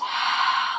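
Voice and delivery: long audible sigh out; dramatic sigh. Shown as a demonstration. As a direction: open the mouth and sigh it all out. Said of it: "big sigh out".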